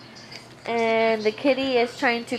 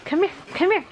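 high coaxing call to the dog; 8 semitones higher, much faster than usual. Real, not acted. Owner calling her dog over, high coaxing call. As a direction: sing it up to lure her over.

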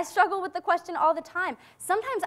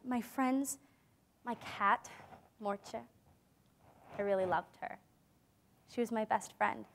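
soft, lower, grieving voice; sad and quiet; 5 semitones lower, 11 dB quieter than usual. Acted. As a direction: let the loss of her friend pull the voice down. Mourning her lost cat and friends.

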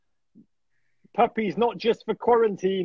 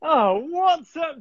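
higher, slow, delighted hello; delighted greeting; 7 semitones higher, slower than usual. Real, not acted. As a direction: greet a friend you're thrilled to see. Greeting guest warmly.

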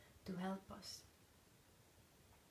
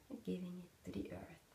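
ordinary talk

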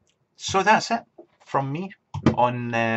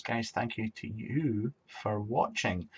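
slow, drawn-out sign-off; slow each word right down; talking slowly; 6 dB quieter, slower than usual. Real, not acted. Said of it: "why am I talking so slowly?".

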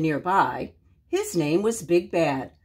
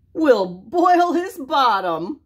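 chuckled; 6 semitones higher, 5 dB louder, slower than usual. Acted. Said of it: "they chuckled".

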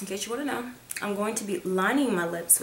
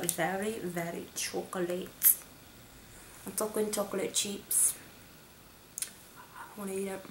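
soft craving; 14 dB quieter than usual. Real, not acted. Craving chocolate.

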